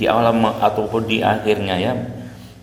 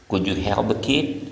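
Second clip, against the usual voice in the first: put-on "Could you help the kid?" with pitch rising on "kid"; rising end tune. Shown as a demonstration. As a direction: end on an upward lift. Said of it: "intonasinya naik di akhir".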